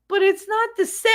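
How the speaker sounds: high pouty whine; pouting kid whine. Retold or imitated. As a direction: sulk it like a disappointed kid. Pouting child self quoted.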